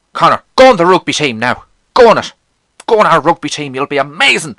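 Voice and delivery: high, loud pestering teacher impression; Mister Frederick badgering. Jovial PE teacher recruiting for rugby, imitated badgering. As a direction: egg the kid on loudly, mimicking a pushy teacher. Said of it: "He always used to badger me".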